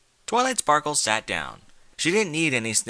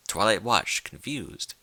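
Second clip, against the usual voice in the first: plain reading voice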